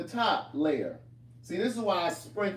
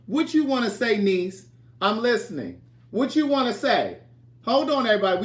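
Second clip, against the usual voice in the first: higher and louder, playful calling; 4 semitones higher, 6 dB louder than usual. Real, not acted. Coaxing niece Kim to speak up, loud and playful. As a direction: call across the room to her.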